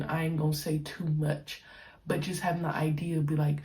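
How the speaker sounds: ordinary delivery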